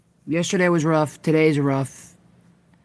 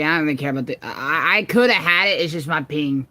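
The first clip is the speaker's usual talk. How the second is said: ordinary delivery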